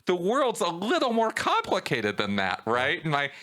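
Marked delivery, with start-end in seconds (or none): 0.1-2.1 rising into a high, incredulous rant; voice getting high. Retold or imitated. Podcast host ranting about conspiracy theorists, voice going high. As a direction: pitch climbing higher and higher, exasperated. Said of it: "my voice gets high".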